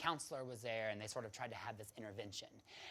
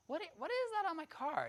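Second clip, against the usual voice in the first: surprised; 3 dB louder than usual. Retold or imitated. Spotting something under her car.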